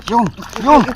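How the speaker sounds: loud happy cry; excited shout. Real, not acted. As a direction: shout it out with joy. Catching fish in the net.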